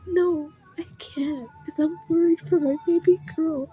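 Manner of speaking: high, tearful pleading; really choked up while retelling; crying. Real, not acted. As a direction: plead it through welling tears. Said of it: "I'm already crying".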